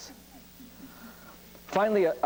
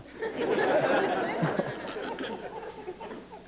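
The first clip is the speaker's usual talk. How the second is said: laughter; 4 dB quieter than usual. Real, not acted. Audience laughs at joke.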